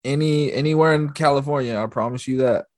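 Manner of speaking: high, hammed-up local pride; proud tour guide. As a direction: sell the city, half joking. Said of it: "I was like".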